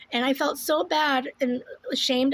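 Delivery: normal talking voice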